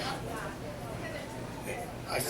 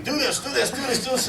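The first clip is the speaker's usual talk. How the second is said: high, repetitive imitation of bossy dictating; imitated rapid-fire bossing; dictating orders; 9 semitones higher than usual. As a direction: rattle off orders in a mock-high voice. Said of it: "she's over there dictating".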